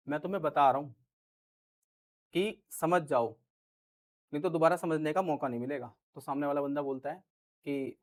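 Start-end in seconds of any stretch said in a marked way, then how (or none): 0.1-5.9 veiled threat. Shown as a demonstration. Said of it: "वो धमकी दे रहा है".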